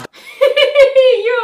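high, loud cackling laugh; burst of laughter. Real, not acted. Reactor cracking up at Jimmy's silly rap line, loud laughter. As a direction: crack up hard, pitch way up.

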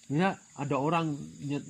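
ordinary delivery

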